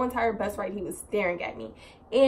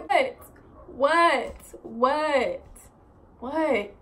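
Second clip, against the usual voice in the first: higher-pitched, exasperated mock "what?"; retold bewildered reaction; 4 semitones higher, much slower than usual. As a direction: act out baffled disbelief, pausing between repeats. Reenacts reaction to being stared at.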